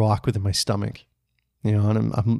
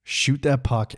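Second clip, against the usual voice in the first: usual speaking voice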